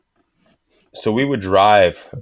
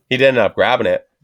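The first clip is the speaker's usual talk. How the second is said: ordinary delivery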